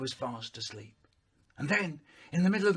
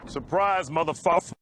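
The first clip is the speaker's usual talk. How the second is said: spring out at them shouting; mock surprise yell. Sudden shout in the dark.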